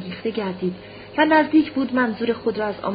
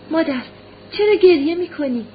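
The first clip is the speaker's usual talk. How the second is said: put-on high, raised little-boy voice; concerned child; 6 semitones higher, 5 dB louder than usual. A narrator's reading. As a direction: ask mother like a worried small child. Little boy asks his weeping mother. (با صدای زیر کودکی نگران)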